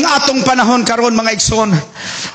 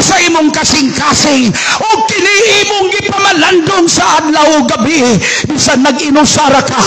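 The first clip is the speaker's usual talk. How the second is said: rousing sermon crescendo; full-force preaching; 6 semitones higher than usual. Acted. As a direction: build each repeat higher and stronger. Preacher building a refrain.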